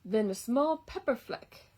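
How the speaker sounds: lively storybook read-aloud; reading to children. A narrator's reading. Reading a picture book to children, lively storytime voice. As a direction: read it like story time for little kids.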